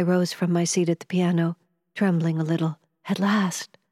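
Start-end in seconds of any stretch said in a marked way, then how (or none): none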